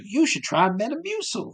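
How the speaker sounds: high chirpy ad voice; imitating the friend in the ad recommending Metamucil, high and chirpy; mock perky friend. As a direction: pitch it up like a cheerful sales pitch. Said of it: "the other broad's like".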